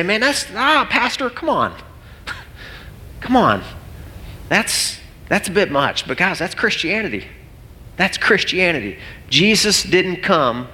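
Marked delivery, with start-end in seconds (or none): 0.0-6.1 high-pitched, pleading objection; acted sceptical protest. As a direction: push back on the pastor in mock disbelief. Voicing sceptical listeners' objection to pastor.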